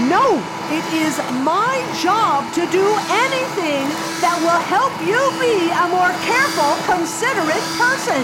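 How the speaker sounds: loud, high scolding yell; shouting mother. Acted. Mother shouting at her daughter about being careful. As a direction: yell it like an exasperated mom.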